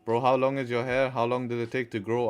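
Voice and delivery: ordinary delivery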